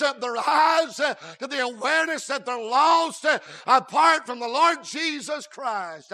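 loud, rising preacher's drive; preaching at full force. Acted. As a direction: build it up to the congregation. Preaching on conviction.

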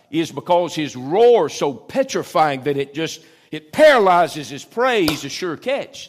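full-voiced pulpit build-up; rousing preaching. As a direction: preach it high and loud, building fire. Preaching the lion's roar.

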